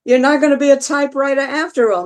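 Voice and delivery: high, loud thrilled outburst; excited inner voice. Retold or imitated. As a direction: burst out with thrilled joy. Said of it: "something in your head is saying".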